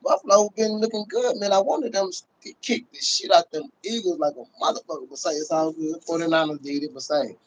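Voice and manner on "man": normal talking voice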